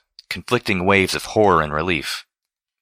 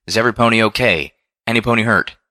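higher-pitched call to a crowd; raised, urgent questioning call; 4 semitones higher, 4 dB louder than usual. A narrator's reading. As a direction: call out to check on everyone. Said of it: "calling out".

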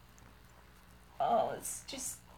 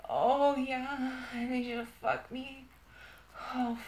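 ordinary talk

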